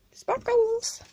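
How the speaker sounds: very high, delighted squeal. Real, not acted. Spotting sparkly paper in the package, squealing with glee. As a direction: squeal as if finding treasure.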